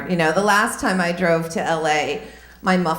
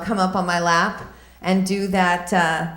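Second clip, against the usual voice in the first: usual speaking voice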